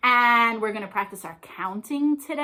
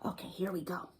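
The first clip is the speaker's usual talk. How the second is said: whisper; 4 semitones lower, 12 dB quieter, faster than usual. Real, not acted. Quiet aside before reading.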